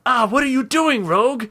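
high put-on creature voice; alarmed door knocker. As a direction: protest as a door being torn away. Door ripped off its hinges protests.

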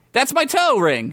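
high-pitched protest without much volume; indignant shout. A narrator's reading. Skeleton protests to adventurer stealing toe ring, shouting. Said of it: "he shouted".

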